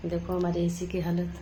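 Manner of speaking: sleepy household at one a.m., softly murmured update; late night hush. Real, not acted.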